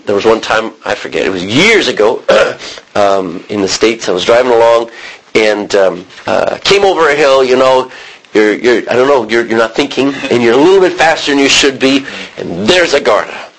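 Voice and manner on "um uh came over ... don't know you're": normal talking voice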